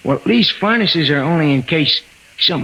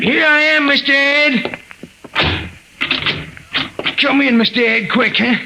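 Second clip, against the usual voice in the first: eager greeting; 12 semitones higher than usual. Acted. Maxie hurrying Mister Ed inside, eager and high. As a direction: hurry him in, anxious and eager.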